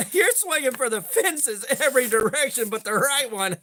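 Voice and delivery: amused, laughing at wild guesses; real laugh breaking into the words. Real, not acted. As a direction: talk through laughter. Amused by wildly wrong country guesses.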